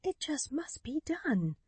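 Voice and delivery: tense whisper through set teeth; grim, determined whisper. A narrator's reading. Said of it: "setting her teeth hard".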